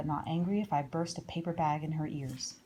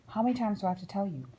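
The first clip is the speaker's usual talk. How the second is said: rattled off fast, a parent at the end of their tether; fast exasperated nag; 3 semitones higher, faster than usual. Acted. A grown-up nagging a child yet again, fast exasperated repeat. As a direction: spit it out quickly, fed up.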